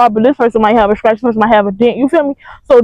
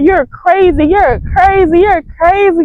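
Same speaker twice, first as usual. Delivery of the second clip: higher-pitched retold protest; mock exasperation; 6 semitones higher, slower than usual. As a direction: repeat it insistently, pitched up. Acted retort in quarrel.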